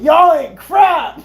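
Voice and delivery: high, loud, drawn-out accusation; hammed-up indignant yell, slow; mock outraged shout. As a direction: holler it at your buddies. Acts out turning on friends.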